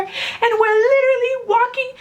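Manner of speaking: high, stretched-out squeal of words; excited fangirling. Retold or imitated. Fan retells walking toward her idols, high excited squeal. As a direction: let the pitch shoot up and stay there, overwhelmed.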